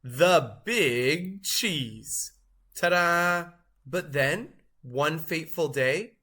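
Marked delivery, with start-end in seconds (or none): 0.0-2.3 slow, drawn-out showman's proclamation; booming title reveal; grand announcement. Acted. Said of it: "say it with me". 2.8-3.5 ta-da. Acted. Cheese showing off its title.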